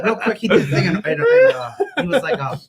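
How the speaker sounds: laughing through the words, pitch jumping up; can barely get it out for laughing; giggling while starting the story. Real, not acted.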